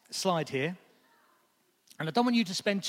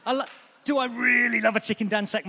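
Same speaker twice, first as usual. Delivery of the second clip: mock self-doubt; 5 semitones higher, 6 dB louder than usual. Real, not acted. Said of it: "So maybe that's number one".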